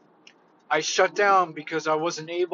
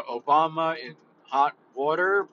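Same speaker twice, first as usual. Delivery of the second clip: ordinary talk, nothing marked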